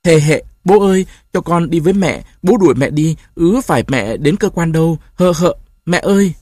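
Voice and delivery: giọng trẻ con cao, nức nở gọi mẹ; khóc lóc đòi đi theo mẹ; bé gái khóc đòi mẹ. Acted. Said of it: "Nghe tiếng con khóc". (bé gái khóc với bố, đòi mẹ, giọng cao nức nở)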